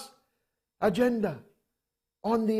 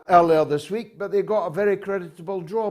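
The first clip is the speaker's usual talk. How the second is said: ordinary talk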